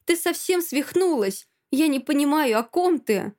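high-pitched indignant denial; alarmed protest. Acted. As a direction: protest in a panic. Frightened friend denies in quarrel.

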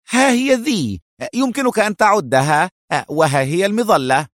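high, eager offering. A narrator's reading. Eliezer hands Adham the money and parachute, eager and high. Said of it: "وهو يقول بلهفة".

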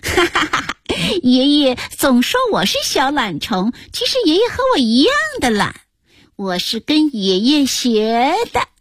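put-on high-pitched little girl, laughing first; gleeful granddaughter. A narrator's reading. Granddaughter teases lazy grandpa, gleeful high child voice. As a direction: crow it with childish delight. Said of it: "高兴地拍着手跳了起来".

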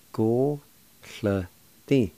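"go, sl, ti." as separate syllables. Shown as a demonstration. Teacher showing learners the second part "go, sl, ti.", syllable by syllable. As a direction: sound out "go, sl, ti." piece by piece. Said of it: "Second part".

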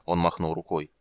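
usual speaking voice

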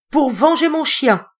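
high, loud playful cry; mock revenge, tossed out high; laughing exclamation. Denise throwing fruit for her dog, high and loud. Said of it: "s'exclama-t-elle en rigolant".

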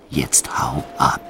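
slow, hushed whisper; whispered order. Retold or imitated. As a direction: hiss the order under your breath. Gunman sends freed guard away.

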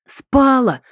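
high, gleeful announcement; joyful. A narrator's reading. Татьяна reports her fever fell, joyfully. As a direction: announce it with sudden joy. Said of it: "радостно сообщила я".

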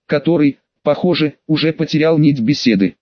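usual speaking voice

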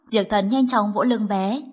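plain reading voice

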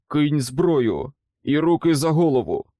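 loud, forceful command; barked order. A narrator's reading. Armed man orders stranger to disarm, loud command.

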